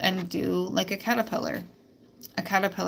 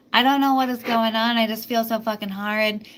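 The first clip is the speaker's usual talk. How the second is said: mock complaint; 3 semitones higher, 4 dB louder than usual. Voicing the excuse she can never say, mock complaint. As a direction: complain loudly as if helpless, half in earnest.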